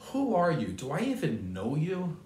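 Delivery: mock indignant questioning of a stranger; stern voice. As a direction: demand it sternly, pitch jumping up. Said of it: "and with a stern voice".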